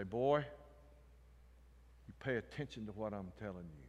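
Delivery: low, hushed stern warning; stern father. Retold or imitated. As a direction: quietly lay down the law like a dad. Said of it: "in a stern voice".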